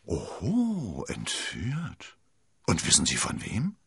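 drawn-out, swooping oho of interest; intrigued young man probing; impressed surprise. A narrator's reading. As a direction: let the surprise curl up, then question. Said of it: "pfiff durch die Zähne".

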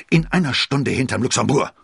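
loud, high, terse challenge; curt snort. A narrator's reading. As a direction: snap out the appointment. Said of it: "schnaubte knapp". (knapp geschnaubt)

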